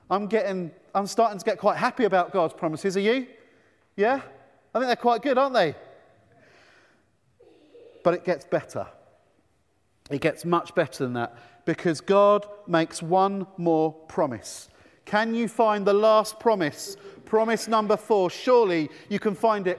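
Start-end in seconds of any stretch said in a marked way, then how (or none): none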